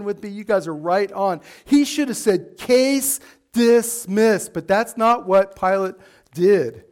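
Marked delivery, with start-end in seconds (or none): 2.6-4.5 very slow, decisive pronouncement; drawn-out, deliberate ruling; judge's ruling. Retold or imitated. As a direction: pronounce it word by word like a verdict. Acts out Pilate's ruling.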